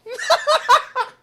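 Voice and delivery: big high burst of laughter; loud laugh. Real, not acted. Vlogger cracking up at his own ASMR joke, loud laughter.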